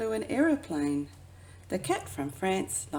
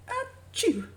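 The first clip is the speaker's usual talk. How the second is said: sneeze. Acted. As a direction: burst out a big cartoon achoo. Cat catches a bad chill.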